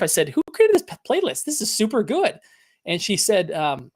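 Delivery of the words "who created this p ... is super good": excited exclamation. Retold or imitated. To his wife about the playlist, a high excited gush. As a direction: gush with sudden excitement over the music.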